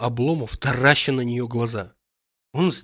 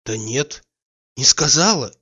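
sharper and louder, losing patience; impatient; 2 semitones higher, 5 dB louder, slower than usual. A narrator's reading. Oblomov presses her impatiently, no she didn't. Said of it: "с нетерпением говорил он".

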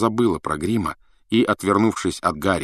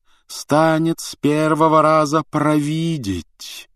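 slow, mystical singsong on a raised pitch; 6 semitones higher, slower than usual. A narrator's reading. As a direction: intone it slowly, stretching the vowels. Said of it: "говорила она нараспев". (нараспев, протяжно)

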